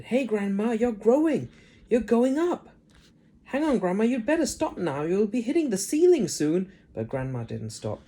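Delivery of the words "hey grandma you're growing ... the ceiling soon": put-on high, louder boyish alarm; alarmed shout. Boy shouting as grandma grows toward ceiling, alarmed. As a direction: call up to her in a panic. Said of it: "George gave a jump and shouted".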